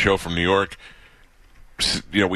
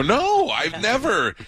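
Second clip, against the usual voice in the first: indignant denial; much slower than usual. Retold or imitated. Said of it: "And I go".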